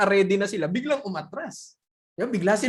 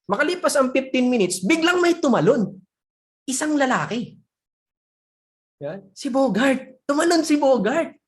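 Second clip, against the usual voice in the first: higher, louder dramatic storytelling; raised, thrilled storyteller's voice; excited reveal; 5 semitones higher, 5 dB louder than usual. A narrator's reading. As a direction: announce the shock twist with a big lift. Suspense breaks as someone jumps in.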